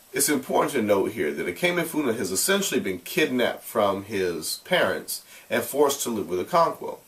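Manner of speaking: ordinary delivery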